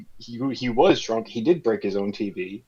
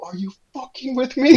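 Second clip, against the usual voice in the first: high, disbelieving mock yell; incredulous outburst; 4 dB louder than usual. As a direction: blurt out total disbelief. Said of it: "not to go".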